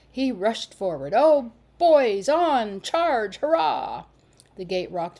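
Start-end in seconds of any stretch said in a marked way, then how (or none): 1.1-4.1 loud, high battle cry; war whoop. A narrator's reading. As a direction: rush in yelling charge. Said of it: "one of his wild war whoops".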